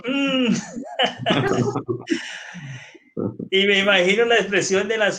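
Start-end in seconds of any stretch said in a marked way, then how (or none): 0.1-0.5 high drawn-out doubtful mm; mimicking the husband's hesitant hum; mock doubtful hum. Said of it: "que dijo". 0.6-2.8 real amused laugh; short laughter on the husband's hum. Real, not acted. Laughing at the joke.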